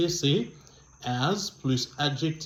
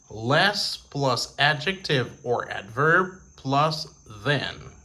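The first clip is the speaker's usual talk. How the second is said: slow dictation; slower than usual. Shown as a demonstration. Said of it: "teacher dictating the formula".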